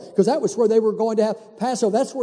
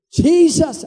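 exasperated; 7 semitones higher, 5 dB louder than usual. Retold or imitated. Exasperated at the news.

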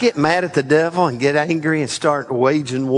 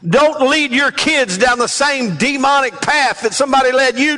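full-force revival preaching; rising pulpit delivery; 5 semitones higher than usual. Acted. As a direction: drive the congregation forward, preacher-style. Preaching climax.

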